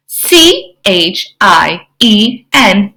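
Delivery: slow, separate letters; spelling out. Shown as a demonstration. Spelling a word while reading.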